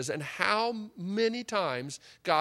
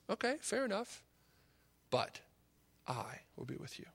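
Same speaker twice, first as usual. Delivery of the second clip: gentle reassurance; 9 dB quieter than usual. Acted. God's gentle reply voiced.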